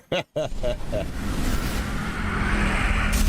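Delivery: a long airy burst of laughter, trailing off; breathless laugh. Real, not acted. Laughing at a friend's blushing, breathless laugh. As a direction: laugh it out helplessly.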